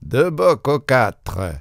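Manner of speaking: high, slow waiter's call; drawn-out faraway shout, not full force; distant voice. A narrator's reading. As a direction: relay an order as if from afar. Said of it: "Une voix lointaine répéta".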